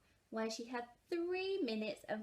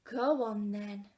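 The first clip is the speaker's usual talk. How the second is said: sighed; 6 dB louder, slower than usual. A narrator's reading. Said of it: "sighed Missus Large".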